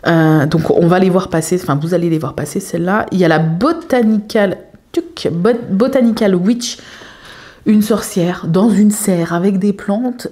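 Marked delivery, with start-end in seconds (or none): none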